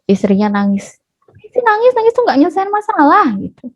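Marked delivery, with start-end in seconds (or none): 1.4-3.3 high, mock exasperated husband; husband's scolding. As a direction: act a husband dismissing his wife's tears. Insensitive husband scolds crying wife. (omelan suami dengan nada tinggi)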